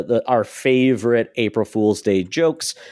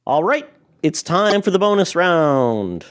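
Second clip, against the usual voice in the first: drawn-out, raised game-show style announcement; segment announcement; 7 semitones higher, slower than usual. Acted. Host kicking off the bonus round, announcer style. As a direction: announce it big and slow, pitched up.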